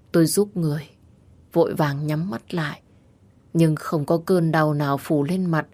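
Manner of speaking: plain reading voice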